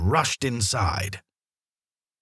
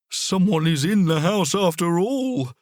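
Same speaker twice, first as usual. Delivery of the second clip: dog's excited thought; 7 semitones higher than usual. A narrator's reading. Solo the dog thinks it to himself, eager. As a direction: voice Solo realising someone's inside. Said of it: "thought Solo".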